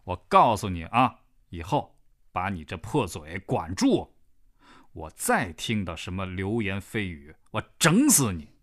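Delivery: loud, hard threat ending in a shout; menacing threat. Acted. Man warns the gossip to shut up, loud menacing threat. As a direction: threaten him to his face, loudly.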